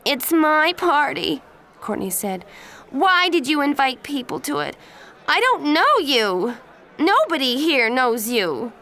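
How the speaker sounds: high, loud outraged complaint; a spoiled girl defending her party; furious. Acted. Birthday girl furious at intruder.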